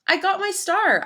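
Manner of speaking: high, loud proud announcement; excited. A narrator's reading. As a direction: burst out with pride. Proudly tells librarian about star.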